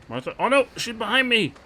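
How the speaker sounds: panicked high cries; startled, voice jumping up; being chased. Real, not acted. Chased by Martha in horror game.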